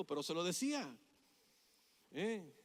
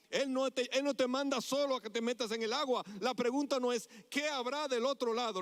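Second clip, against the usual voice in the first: raised, emphatic sermon delivery; impassioned preaching; 6 semitones higher, 3 dB louder than usual. Real, not acted. Preacher assuring the congregation God goes with them, impassioned. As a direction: drive each promise home to the congregation.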